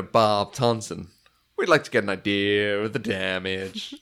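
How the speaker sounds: a light put-on American accent, slow at the start; a hint of an American accent; imitating his American accent. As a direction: mimic the actor's fake American accent. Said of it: "he's got this accent".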